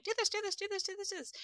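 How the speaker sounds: high, soft whiny nagging; nagging little sister. Retold or imitated. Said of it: "your nagging little sister saying".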